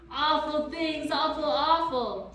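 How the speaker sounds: high, loud, slow creature mockery; yelled mockery. A narrator's reading. Furry horde mocking the boy, yelling. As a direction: bellow it back mockingly. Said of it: "yelled the furry horde".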